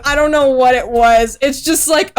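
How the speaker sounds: ordinary talk, nothing marked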